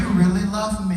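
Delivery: high, sweetly grateful reply; mock touched. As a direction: answer the yelling as if it were love.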